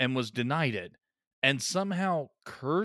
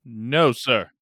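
loud, hammed-up objection, second louder; mock indignant refusal; 10 dB louder, much slower than usual. Defending Fluffy from being called rabid, mock indignant. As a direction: refuse loudly in pretend outrage.

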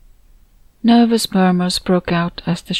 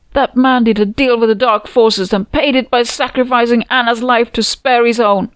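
loud, high raving accusation; screaming accusation; 5 semitones higher, 5 dB louder than usual. A narrator's reading. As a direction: rave at the crowd. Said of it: "to scream".

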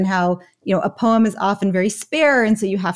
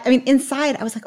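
ordinary delivery

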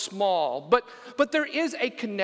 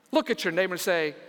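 normal talking voice

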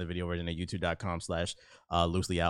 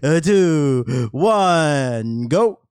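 loud countdown; 5 semitones higher, 11 dB louder, much slower than usual. Real, not acted. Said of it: "counting in the intro".

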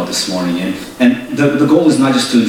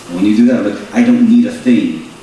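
normal talking voice

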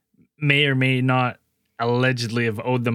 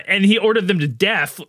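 ordinary talk, nothing marked

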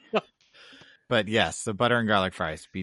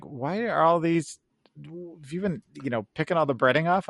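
a lightly voiced mother asking, puzzled; mom's suspicious question; 6 semitones higher than usual. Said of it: "And she'd be like".

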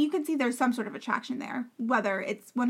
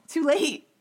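mocking echo; 3 semitones higher, 5 dB louder than usual. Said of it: "I'm like".

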